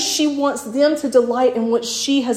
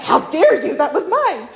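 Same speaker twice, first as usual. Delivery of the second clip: mock outrage; 5 semitones higher than usual. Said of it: "And she's like".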